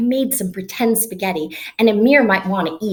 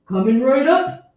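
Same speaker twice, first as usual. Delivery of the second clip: a lightly put-on cook's reply; chef voice; 3 semitones higher than usual. Pretend chef answering the server: lightly voiced cook.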